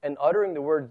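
usual speaking voice

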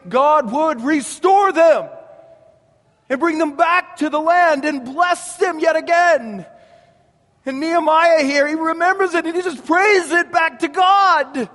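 high, loud preacher's shout; preaching at full force. Real, not acted. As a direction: build the sermon to a cry. Preaching swell.

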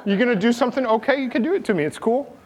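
high, chummy voice of a calm sheep; a lightly silly sheep saying sure, go ahead; relaxed sheep voice. Retold or imitated. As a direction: play the sheep, easygoing and cheerful. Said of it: "the sheep's like".

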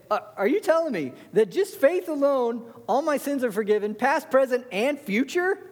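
high, disbelieving challenge; mock-incredulous objector; incredulous questioner. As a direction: voice a doubter who can't believe it. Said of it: "Or they would say".

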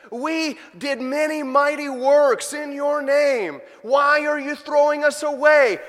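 high, loud, slow desperate pleas; desperate cries of the rejected; pleading condemned men. Acted. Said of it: "they're pleading".

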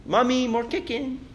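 imitating a toddler asking for food; little-kid voice with baby-talk consonants; lisping toddler plea; small child's voice. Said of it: "if Margaret wants more chicken".

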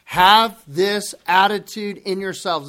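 a firmer second reading; emphatic reading. Shown as a demonstration. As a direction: press the command home. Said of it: "Read it again".

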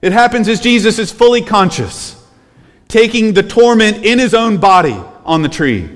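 high, loud impassioned preaching; preaching at full force. Acted. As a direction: cry it out to the congregation. Preaching climax.

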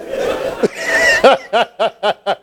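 a high squealing laugh; high laugh at his own story; high-pitched laugh. Real, not acted. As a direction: burst into a high giggle. Laughs at irony.

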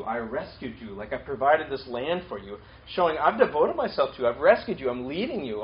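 ordinary talk, nothing marked

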